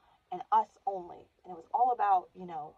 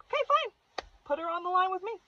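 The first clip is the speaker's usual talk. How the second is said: much higher, exasperated okay; giving in; 13 semitones higher, 4 dB louder than usual. Retold or imitated. Shaman friend giving in, higher exasperated. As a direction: throw up your hands and give in.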